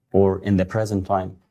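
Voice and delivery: usual speaking voice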